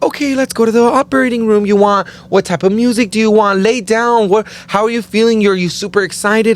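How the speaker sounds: high, bright imitation of the cheerful nurses; chirpy nurse voice. Nurses cheerfully asking the patient before surgery, high and sweet. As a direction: lift it up like a sunny nurse. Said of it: "They were like".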